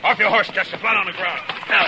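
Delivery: urgent order. Acted. Sudden danger, ordering Chester down.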